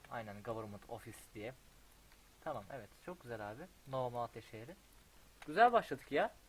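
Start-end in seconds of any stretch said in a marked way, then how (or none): 5.5-6.4 much higher and louder happy cry; delighted. Real, not acted. Player thrilled with how his city begins, loud and high. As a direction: burst out in delight at the start.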